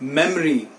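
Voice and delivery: मेमोरी in two syllables, mem-ree; correct pronunciation. Shown as a demonstration. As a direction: drop the middle o in मेमोरी. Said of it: "एक बार फिर से बोलिए". (मेमोरी का सही उच्चारण, मेम-री)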